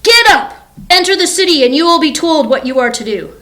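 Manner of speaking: high, loud divine command; best God voice. Acted. The Lord orders Saul into the city, commanding God voice. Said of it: "this is my best God voice".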